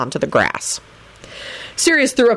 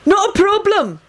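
high, placating call; hopeful; 14 semitones higher than usual. A narrator's reading. Captive Benji placates the gang, high and hopeful. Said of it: "voice rang out hopefully".

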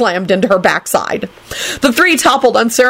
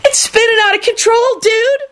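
extremely high, hammed-up screech. A narrator's reading. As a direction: screech it in lovesick melodrama. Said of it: "Kenny screeched".

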